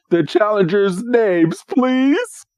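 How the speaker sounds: a grand herald asking names; formal knight. Retold or imitated. Wickstrom asks the challengers' names, high and formal. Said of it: "And Wickstrom is like".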